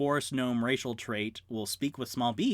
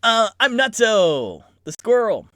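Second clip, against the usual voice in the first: squirrel voice; 9 semitones higher, 12 dB louder than usual. Acted. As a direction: boom out a daredevil squirrel intro. Squirrel introduces itself.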